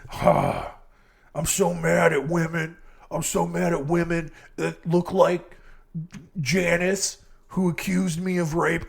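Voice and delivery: put-on laid-back surfer bro accent for a South African killer; mock him as a whiny surfer bro accent. Mocking the killer's grudge against women, surfer bro accent. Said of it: "a South African sounds like a surfer bro".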